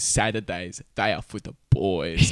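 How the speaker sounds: smug bro voice, slowing at the end; douchey voice. Pretend. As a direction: read it like the douchiest gym bro. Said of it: "With the douchiest one you can find".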